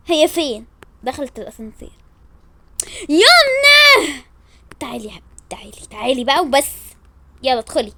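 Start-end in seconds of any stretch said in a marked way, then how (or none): 3.1-4.2 long, high, loud call; drawn-out delighted shout. Acted. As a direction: call her from down the hall. Calling out to friend.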